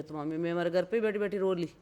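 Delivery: ordinary delivery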